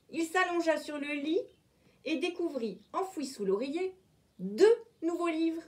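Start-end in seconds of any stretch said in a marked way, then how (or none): none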